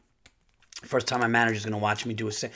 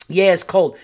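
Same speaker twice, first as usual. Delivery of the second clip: imitating hygienist; 4 semitones higher, 8 dB louder than usual.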